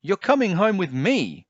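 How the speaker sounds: Jiminy ordering Pinocchio home, high exclamation; crying out. A narrator's reading. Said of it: "Jimmy cried".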